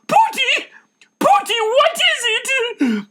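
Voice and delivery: high, loud, slowed panicked calling; frightened cry. Acted. Missus Van Dan running down to her husband, frightened cry. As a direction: call your husband's pet name in fear. Wife rushing down the stairs.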